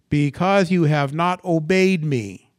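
slow, loud, measured reprimand; God's rebuke. Acted. God answering Israel's complaint, slow loud rebuke. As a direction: lay down the verdict word by word, loudly.

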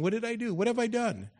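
high, whiny protest; mock David. Pastor imitating David's constant protest, high and whiny. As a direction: whine it like David. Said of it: "that's all he was saying".

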